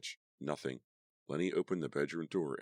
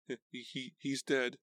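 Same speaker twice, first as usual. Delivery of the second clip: stammering shock; 10 semitones higher, slower than usual. A narrator's reading. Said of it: "I repeated".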